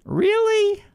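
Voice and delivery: mock disbelief. Real, not acted. Repeated sarcastic disbelief.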